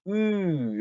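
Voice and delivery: a long ü with lips kept rounded for "으"; ü sound. Shown as a demonstration. Teacher shows learners the sound, long rounded ü. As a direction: say "으" as German ü, lips rounded for oo while voicing ee. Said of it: "요거 발음".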